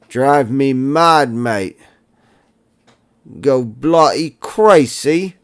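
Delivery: mock English accent. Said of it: "I don't know about my English accent".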